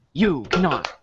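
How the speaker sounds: usual speaking voice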